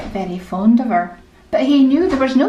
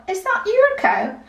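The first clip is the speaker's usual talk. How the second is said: put-on surprised girl; 10 semitones higher than usual. Girl amazed by the cow.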